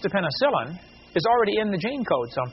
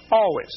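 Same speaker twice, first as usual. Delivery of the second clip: "Always" said higher and louder with a falling end; emphatic Always; 4 semitones higher, 4 dB louder than usual. Real, not acted. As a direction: land "Always" hard as a final word. Insisting on his point.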